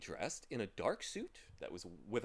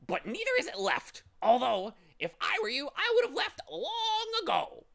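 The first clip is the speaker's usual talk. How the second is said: high, loud, pestering bird; contrary bird; 9 dB louder than usual. Acted. Bird taking everything the wrong way, high and loud. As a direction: twist their words in a high bird voice.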